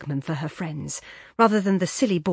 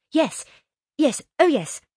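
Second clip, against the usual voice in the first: high, bright-eyed eager agreement; high, loud telephone chatter; eager phone answer; 9 semitones higher, 7 dB louder than usual. A narrator's reading. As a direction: agree loudly and eagerly down the line.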